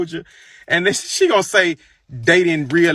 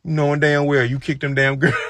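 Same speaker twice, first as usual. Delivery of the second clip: ordinary delivery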